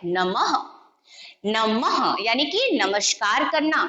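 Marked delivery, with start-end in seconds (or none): 0.0-0.5 नमः, final h audible; visarga pronunciation. Shown as a demonstration. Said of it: "तो बोलते हैं".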